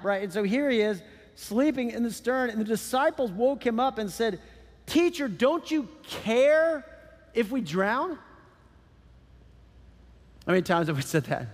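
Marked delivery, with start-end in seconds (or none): 4.9-8.2 higher, drawn-out appeal with a long CARE; acted pleading. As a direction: plead as a mildly desperate disciple. Disciples pleading in the storm.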